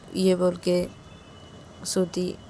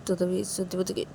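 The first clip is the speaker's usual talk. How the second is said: ordinary delivery, nothing marked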